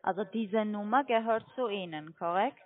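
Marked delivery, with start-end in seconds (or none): none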